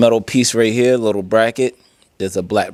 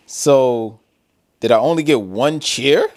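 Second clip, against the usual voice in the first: ordinary talk, nothing marked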